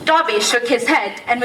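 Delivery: ordinary delivery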